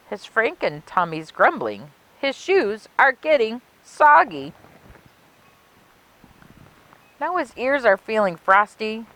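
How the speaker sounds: put-on lilting rhymed read-aloud for children; storybook narration. A narrator's reading. Librarian reading a Halloween rhyme to kids, storybook lilt. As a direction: savor each rhyme like a spooky bedtime tale.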